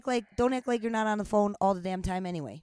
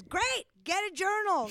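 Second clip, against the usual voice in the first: much higher, mock-cheerful retort; sarcastic dismissal; 10 semitones higher than usual. Real, not acted. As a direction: fire back with high-pitched false enthusiasm. Sarcastic retort to the mimicked listeners.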